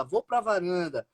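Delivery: ordinary delivery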